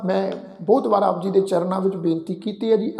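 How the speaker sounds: usual speaking voice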